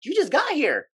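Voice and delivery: imitating his own outraged comeback to a coworker; incredulous retort. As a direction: toss it back like you can't believe the nerve. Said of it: "And I'm like".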